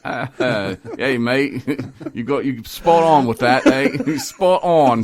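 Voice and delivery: loud, high mock New Zealander, laughing through it; New Zealand accent. Host mimics laughing New Zealand listeners, hammed-up New Zealand accent. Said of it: "New Zealand people are laughing. They're like".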